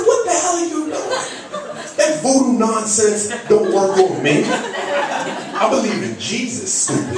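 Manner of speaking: high, loud, drawn-out protest; hammed-up righteous outrage, words stretched; indignant retort. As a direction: fend her off in mock indignation. Acted outraged reply to the attacker.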